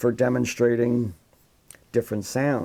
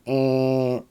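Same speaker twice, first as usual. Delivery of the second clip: imitated electronic buzzer "eh"; sharp square wave buzz. Shown as a demonstration. Teacher imitating a square wave sound, sharp buzz. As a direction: hold a hard buzzer tone on one note. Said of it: "the actual sharpness of it".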